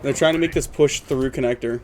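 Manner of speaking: energetic esports caster call; live play-by-play. Acted. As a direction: call the round as it happens, building. Casts the match live.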